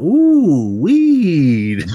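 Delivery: high, loud mock delight; acted delighted discovery. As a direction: exclaim it high and loud, like finding treasure. Snooper discovers a stash.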